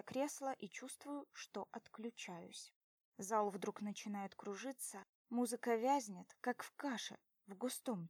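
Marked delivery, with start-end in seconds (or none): none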